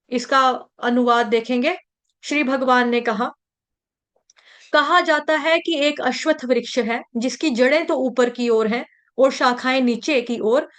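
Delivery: ordinary talk, nothing marked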